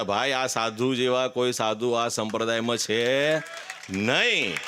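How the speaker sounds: slow, weighed words ending on a long rise; earnest slow declaration before the congregation; slow emphatic claim. Real, not acted. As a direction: lay each word down deliberately to the audience. Declares no saint compares.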